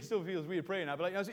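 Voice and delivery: ordinary talk, nothing marked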